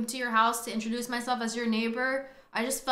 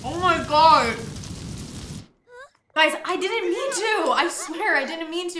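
panicked pleading; 6 semitones higher, 6 dB louder than usual. Real, not acted. Pleading after starting fire.

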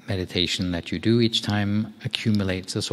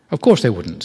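a louder, hammed-up angry retort; 4 semitones higher, 9 dB louder than usual. A narrator's reading. Boy refusing the abbot's offer for his finger, angry. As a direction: snap it back at the abbot, offended. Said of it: "said the boy angrily".

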